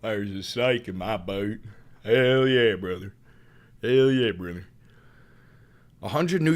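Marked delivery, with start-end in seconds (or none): 0.0-1.6 slow put-on cowboy drawl; slow mock cowboy drawl; cowboy catchphrase. 2.0-4.7 hammed-up Southern good ol' boy; mock Southern catchphrase.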